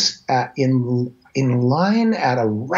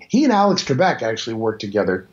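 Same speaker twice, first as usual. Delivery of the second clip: usual speaking voice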